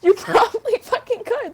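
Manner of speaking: high laughing agreement; amused laugh mid-line. Real, not acted.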